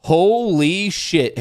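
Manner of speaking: slow stunned exclamation; real shock at the card, drawn out; surprised. Real, not acted. Reacts to pulled card.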